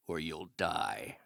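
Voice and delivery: softly ominous; hushed threat. Acted. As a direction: let it sink to a deadly quiet. Quiet threat.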